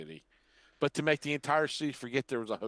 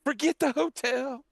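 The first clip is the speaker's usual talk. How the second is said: high, slow mock command; hypnotic villain; 14 semitones higher, 5 dB louder, slower than usual. Mocking the movie villain, high slow hypnotic command. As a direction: intone it like a villain hypnotizing the city.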